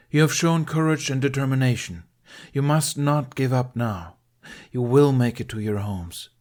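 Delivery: steady the panicked crowd; encouraging address. Acted.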